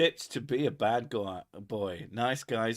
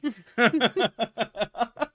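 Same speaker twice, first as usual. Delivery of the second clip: long rising high laugh; 12 semitones higher, 6 dB louder than usual. Real, not acted. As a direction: cackle up high at the joke. Laughing at the stupid ad.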